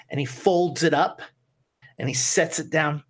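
ordinary talk

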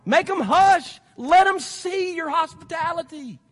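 high, loud, drawn-out exhortation; full-force preaching. Real, not acted. Preacher telling parents to take kids along, full-force exhortation. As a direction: drive each command home to the congregation.

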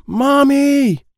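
high, loud yell for mom. Acted. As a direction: shout as loud as you can. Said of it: "I have to be loud".

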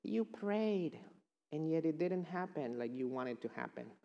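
mocking enemy voice with a sly laugh; the enemy's taunting voice. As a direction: gloat like a sly tempter. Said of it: "the enemy comes to you and says".

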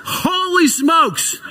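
high, drawn-out laughing exclamation; startled laugh. Real, not acted. The preacher is startled by the whole room's yell and laughs. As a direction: caught off guard and laughing.

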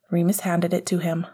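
usual speaking voice

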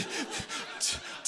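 quiet breathy chuckle at a slip of the tongue; short muffled giggle; soft laugh. Real, not acted.

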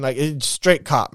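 ordinary talk, nothing marked

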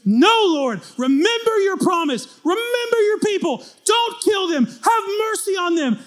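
high, shouted pleading; desperate plea. Acted. Moses crying out loudly to the Lord for mercy. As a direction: beg God at full voice.

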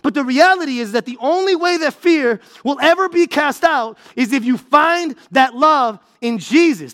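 usual speaking voice

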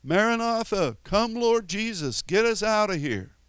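mock the pew-sitters begging to be taken away; preacher mimicking lazy believers crying out to be taken home, high and whiny; mocking passive churchgoer. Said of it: "we don't just sit in the back pew of the church saying".

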